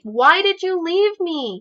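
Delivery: hurt and angry, demand why they left; mad. Retold or imitated. Confronting the family member who wandered off, loud and upset. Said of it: "like, mad".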